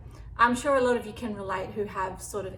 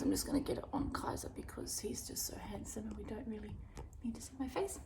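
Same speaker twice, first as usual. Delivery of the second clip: whisper; 10 dB quieter, faster than usual. Real, not acted. Whispered aside while filming the dog.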